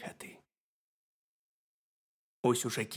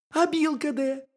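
high, squeaky little hedgehog; very high, loud cartoon critter voice; 6 dB louder than usual. A narrator's reading. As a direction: pipe it up as a tiny curious hedgehog. Hedgehog keeps questioning the bear.

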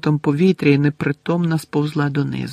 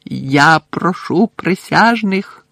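slow, louder command; shouting; 4 semitones higher, 4 dB louder, slower than usual. A narrator's reading. Crouch shouting to the jury. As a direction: call the jury firmly. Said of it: "закричав містер Кравч".

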